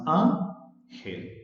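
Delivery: "Ángel" split slowly as án-gel; "Ángel" with a clear gap between án and gel; broken into syllables. Shown as a demonstration. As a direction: say "Ángel" as two separate syllables. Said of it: "Ángel tiene dos sílabas".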